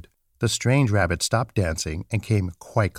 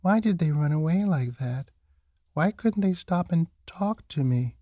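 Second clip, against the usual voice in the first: higher, forlorn small voice; little rabbit thinking; 6 semitones higher than usual. A narrator's reading. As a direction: wonder to yourself as a lonely little rabbit. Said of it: "he thought".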